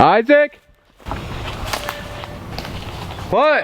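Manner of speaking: high calling shout; yelling across the room. Real, not acted. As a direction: call out to someone far away. Calling out to friends far off.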